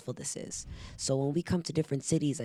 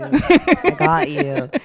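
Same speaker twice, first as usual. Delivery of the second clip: much higher, louder and very slow; drawn-out promise; 12 semitones higher, 12 dB louder, much slower than usual. Retold or imitated. Promising the kids she'll send the video, stretched high and slow. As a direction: stretch it out high and sing-song.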